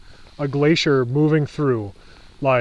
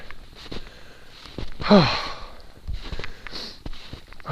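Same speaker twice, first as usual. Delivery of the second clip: long exhausted sigh; 3 semitones higher, 6 dB quieter than usual. Real, not acted. After the climb down, exhausted.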